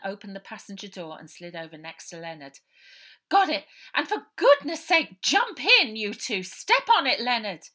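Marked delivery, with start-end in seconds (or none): 3.3-7.7 much higher and louder, hurrying everyone; urgent call. Acted. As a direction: shout them into the van. Character calling to jump in.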